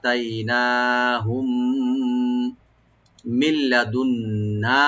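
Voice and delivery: slow, drawn recitation of লাআতাইনাহুম মিল্লাদুন্না; slow recitation demo. Shown as a demonstration. Teacher modelling the corrected phrase slowly. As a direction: recite with careful elongation. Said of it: "লামে কোনো টান হবে না".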